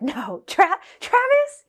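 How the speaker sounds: frantic disbelief with a squeaking high "Travis?"; retelling a friend's panicked denial. Retold or imitated. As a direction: stammer it out, refusing to believe it.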